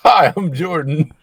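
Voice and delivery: hammed-up British accent, drawn out; mock British accent. Shown as a demonstration. Jordan's acting reel introduction, mock British accent. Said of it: "this is a British accent".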